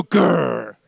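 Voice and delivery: low-pitched growled grr; tiger growl. Shown as a demonstration. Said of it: "do your best grr".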